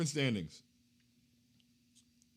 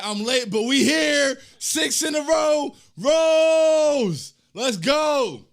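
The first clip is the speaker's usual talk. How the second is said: loud, higher, slowed fan chant; hype shout; 8 semitones higher, 11 dB louder, much slower than usual. Retold or imitated. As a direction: belt it out like a courtside fan. Reading hype chat comment aloud.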